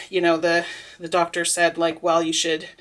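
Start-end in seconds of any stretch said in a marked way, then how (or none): none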